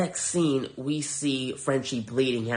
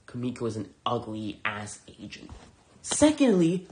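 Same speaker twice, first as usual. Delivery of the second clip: usual speaking voice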